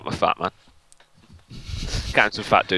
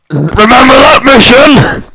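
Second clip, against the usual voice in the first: laughing shout; 13 semitones higher, 17 dB louder than usual. Real, not acted.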